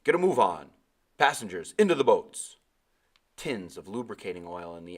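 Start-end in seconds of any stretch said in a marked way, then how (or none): 0.0-2.6 loud, raised captain's order; captain shouting. A narrator's reading. Captain shouting orders to passengers. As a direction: bellow the evacuation order. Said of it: "The captain shouted".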